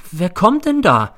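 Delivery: worried question, high, loud and slow. A narrator's reading. Sleepy landlady at a night doorbell, worried. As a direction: ask anxiously, pitch climbing. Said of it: "fragt sie besorgt".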